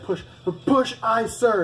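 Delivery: a recruit barking back mid push-up; shouted recruit reply. Retold or imitated. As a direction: yell it back through gritted teeth. Recruit shouting during push-ups.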